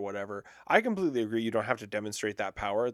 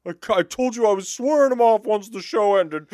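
high, loud complaining voice; pretend Adam Driver; 8 semitones higher, 8 dB louder than usual. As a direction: protest like a grumbling star. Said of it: "Adam, I understand".

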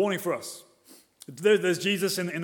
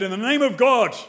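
loud, higher oath in the name of God; strongest oath; 6 semitones higher, 8 dB louder than usual. Retold or imitated. Peter swears before bystanders, loud emphatic oath. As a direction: call on God with full force. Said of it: "in the strongest possible way".

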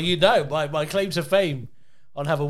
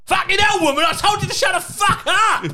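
high, loud mock Cockney rage; angry Cockney dad; 13 semitones higher, 4 dB louder than usual. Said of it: "when he gets angry, he turns Cockney".

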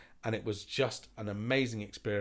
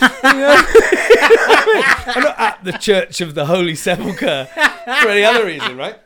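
much higher, louder, slow laughing words; laughing hard; 12 semitones higher, 19 dB louder, slower than usual. Real, not acted. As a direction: squeeze it out through big laughter. Laughing hard at his story.

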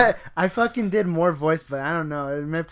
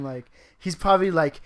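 usual speaking voice

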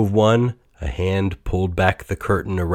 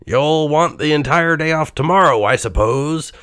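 louder, higher curt miser; snapping Scrooge; 7 semitones higher, 4 dB louder than usual. A narrator's reading. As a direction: snap it at the clerk. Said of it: "Scrooge snapped at Bob".

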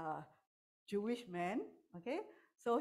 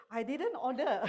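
defensive protest; 6 semitones higher, 8 dB louder than usual. Retold or imitated. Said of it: "normally what we say".

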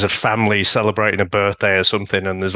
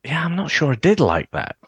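hesitant, higher musing; doubtful second thoughts; 5 semitones higher than usual. Retold or imitated. Fan thinking back on the film, doubtful musing. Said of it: "I'm like".

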